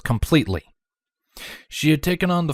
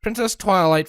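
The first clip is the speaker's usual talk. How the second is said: ordinary delivery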